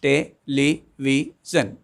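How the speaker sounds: "television" slowly, four separate beats, te-le-vi-sion; "television" drawn out beat by beat; syllables spelled out slowly. Shown as a demonstration. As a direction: stop four times in "television". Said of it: "because I am stopping four times".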